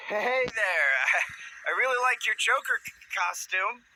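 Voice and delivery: high, loud nervous greeting with a laugh; nervous, stumbling compliment; forced playfulness. Acted. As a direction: marvel playfully while hiding fear. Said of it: "I tried to playfully marvel at his costume".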